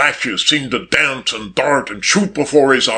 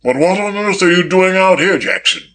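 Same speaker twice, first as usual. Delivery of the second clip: mildly sharp demand, pitched up then dropping on the name; a little sharp; 5 semitones higher than usual. A narrator's reading. Billy Kane questions the footman in surprise, a little sharply. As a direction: question the servant with a touch of sharpness. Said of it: "he demanded a little sharply".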